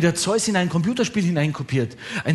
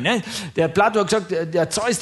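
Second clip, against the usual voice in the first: normal talking voice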